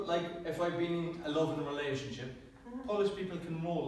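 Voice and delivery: normal talking voice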